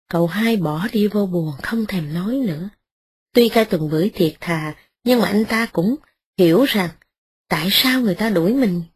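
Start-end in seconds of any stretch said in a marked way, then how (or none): none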